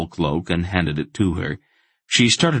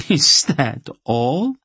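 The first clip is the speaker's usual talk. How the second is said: high, drawn-out amused question; throaty laugh; 9 semitones higher, 4 dB louder, much slower than usual. A narrator's reading. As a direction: laugh richly into the words. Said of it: "she laughed, a rich, throaty sound".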